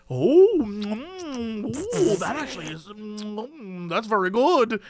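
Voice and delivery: drawn-out appreciative hums and oohs; mock savouring. Customer sampling blonde espresso, imitated savouring surprise. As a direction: taste it and marvel between mms.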